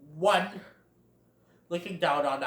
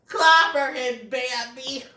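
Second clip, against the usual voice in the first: higher, slow and louder egging on; eager cheering Thumper; 8 semitones higher, 6 dB louder, slower than usual. Retold or imitated. Thumper urging Bambi to fight, eager cheer. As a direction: egg your friend on. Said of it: "Thumper's all like".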